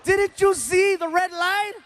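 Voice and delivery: put-on high, loud whiny shriek like a kid. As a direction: squeal like a third grader. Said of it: "I sounded like a third grader".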